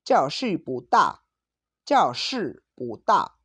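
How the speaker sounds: slow Mandarin, "bu" rising before a sharply falling "da"; bu in second tone. Shown as a demonstration. Teacher showing the tone change to students, "bu" rising in second tone. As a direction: lift "bu" upward, then drop "da" firmly. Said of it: "el tono de pu a segundo tono".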